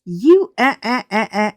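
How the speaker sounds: a hammed-up speechless cuss-out; mock sputtering friend. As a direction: imitate her choking on her words. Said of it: "cussing me out".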